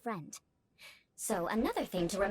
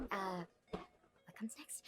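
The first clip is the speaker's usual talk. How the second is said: slow whisper to herself, much softer; whispered aside; 4 semitones lower, 11 dB quieter, much slower than usual. Real, not acted. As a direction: mutter the question under her breath. Forgetting her lines mid-speech.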